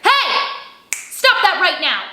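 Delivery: firm, high scolding call; angry mama. Shown as a demonstration. Teacher plays a mama telling off, angry. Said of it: "Like I'm an angry mama".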